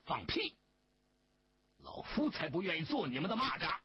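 put-on old official's offended outburst; indignant outburst. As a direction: rebuke them in quick, indignant anger. Official angrily rejects being implicated.

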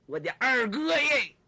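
theatrical exasperation; exasperated appeal. Acted. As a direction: wail it at a brother in mock despair. Zhang exasperated with his brother.

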